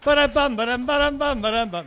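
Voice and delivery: high, loud sung-out ba-dum-bum; mock drum sting. Host teasing after a gag, mock drum sting. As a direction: vocalize a rimshot after a joke.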